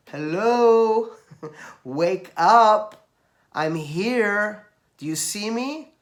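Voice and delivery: high, loud call to wake people up; a voice from above. Retold or imitated. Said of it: "That's someone saying".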